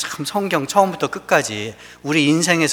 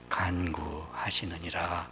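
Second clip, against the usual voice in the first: whisper; 9 semitones lower, 12 dB quieter, slower than usual. Acted. Whisper after quoting Spirit's groaning.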